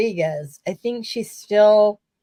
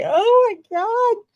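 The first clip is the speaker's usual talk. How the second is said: overwhelmed gasp; 6 dB louder, slower than usual. Retold or imitated. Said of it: "I was like".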